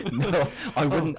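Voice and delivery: loud, high protest through real laughter; slow, helpless laugh on the words; laughing denial. Real, not acted. Host denying he'd drop guest in it, laughing.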